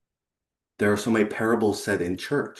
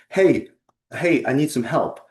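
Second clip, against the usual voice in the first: high, louder urgent call for help; frantic young fish; 6 semitones higher, 4 dB louder than usual. A narrator's reading. Young fish swims up to old fish, playfully frantic. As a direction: call out in storybook panic. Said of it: "comes up all frantic".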